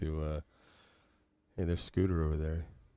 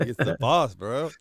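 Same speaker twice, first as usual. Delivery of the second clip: loud, higher hype voice; hyped-up bro; 7 semitones higher, 10 dB louder, slower than usual. Retold or imitated. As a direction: sell it like a big reveal. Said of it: "I'm like".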